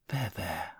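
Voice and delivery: soft murmured there there; murmured comfort. A narrator's reading. Jonty soothes shivering Oti, soft murmur. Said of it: "I murmured".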